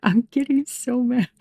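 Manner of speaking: anger breaking into a laugh; laughing exasperation, not shouted; getting mad. Real, not acted. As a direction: mad but half laughing about it. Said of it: "can you hear me getting mad".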